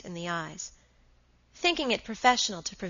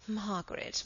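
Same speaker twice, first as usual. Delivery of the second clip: hushed reproach; 7 dB quieter than usual. A narrator's reading. Henry cautions his defiant wife, hushed. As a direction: say the name softly, as a first warning.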